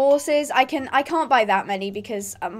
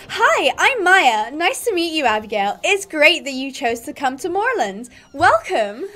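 put-on American accent; 2 semitones higher, 4 dB louder than usual. Stable girl welcoming a new rider, American accent. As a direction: greet in an exaggerated American accent.

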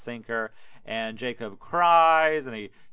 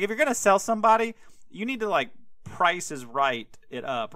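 worked-up rant; 6 semitones higher than usual. Real, not acted. Ranting about pricing a slave.

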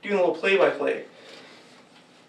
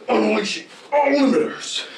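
robot announcer voice; 3 semitones higher, 4 dB louder than usual. Acted. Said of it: "He just goes".

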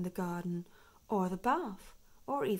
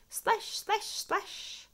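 splash sounds; 13 semitones higher, 4 dB louder, much slower than usual. A narrator's reading. Splashing in bath.

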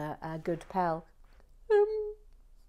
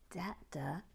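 puzzled whisper; 8 dB quieter than usual. Real, not acted.

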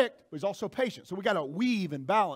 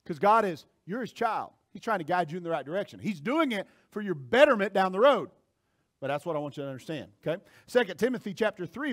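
ordinary delivery

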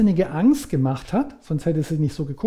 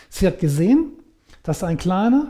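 normal talking voice

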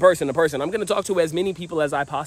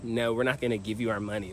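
bored refusal; 4 semitones lower, 9 dB quieter than usual. Retold or imitated. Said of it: "they're just like".

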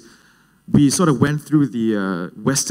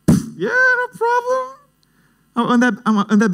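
badly faked no-worries, pitched way up; feigned nonchalance. Acts fake confidence about the money.

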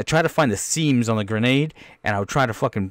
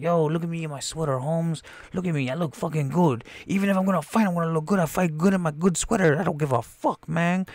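higher, softer cocky voice; vain bragging; 5 semitones higher, 4 dB quieter than usual. Acted. Man showing off his cool sweater, vain bragging. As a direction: show off with mock swagger.

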